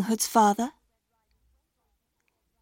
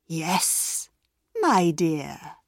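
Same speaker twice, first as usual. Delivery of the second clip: lower, lightly voiced crafty wolf; sly wolf; 5 semitones lower, 4 dB quieter, much slower than usual. A narrator's reading. Wolf answering the girl, a touch lower and sly. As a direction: purr it like a wolf in disguise. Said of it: "said the wolf".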